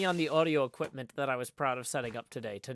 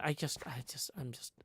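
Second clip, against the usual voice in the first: whispering; 10 dB quieter than usual. Real, not acted. Overwhelmed after spilling drinks.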